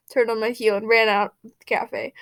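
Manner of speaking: plain reading voice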